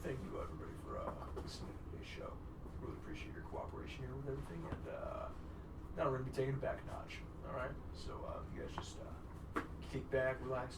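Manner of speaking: soft breathy late-night outro host; Goldbergian voice. Pretend. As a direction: wind the show down in a mellow murmur. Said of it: "practice my Goldbergian voice".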